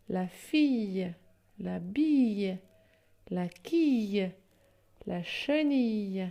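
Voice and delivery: each word slow and clearly set apart; slow word list reading. Shown as a demonstration. Teacher to pupils, slow, careful word-by-word reading. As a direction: read them out slowly, one by one. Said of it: "les mots qui s'écrivent avec I deux L".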